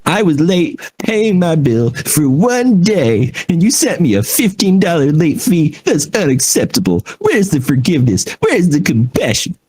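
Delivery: high-pitched mock indignation; hammed-up grouch pleading his grievance; grumpy customer. Customer protesting a late fee, comically grumpy. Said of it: "he sounded so grumpy".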